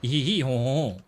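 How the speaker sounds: nasal mock-French honking, as if holding the nose. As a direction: parody a French speaker's nasal sound. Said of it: "sound like they're holding their nose".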